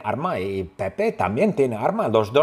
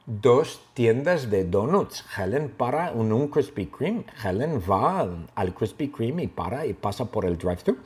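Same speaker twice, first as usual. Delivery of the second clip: plain reading voice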